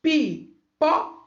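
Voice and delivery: PIPOCA in three beats, pi-po-ca; split into syllables. Shown as a demonstration. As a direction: say Pipoca slowly with a gap after each syllable. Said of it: "Três sons".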